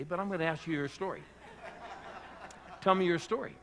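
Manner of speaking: chuckling through the question to the stranger; laughing while asking her story. Retold or imitated. Pastor laughing as he asks a stranger on a plane her story. Retelling his chat with a stranger.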